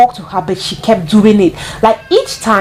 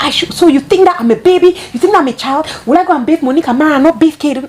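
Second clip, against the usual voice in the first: retold screaming; 7 semitones higher than usual. Imitating the nanny answering about bathing Caden, indignant mock scream. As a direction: fire back offended questions in a raised pitch. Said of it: "it's the way the woman screamed at me".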